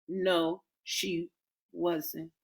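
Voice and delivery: very soft admission; quiet. A narrator's reading. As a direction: admit it barely audibly. Reluctant admission.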